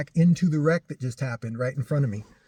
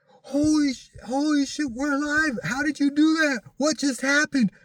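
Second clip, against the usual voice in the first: freaking out; 13 semitones higher than usual. Retold or imitated. Woman in the back seat freaking out after the near miss, high frantic shrieks. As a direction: shriek it like you just cheated death. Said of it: "just going nuts".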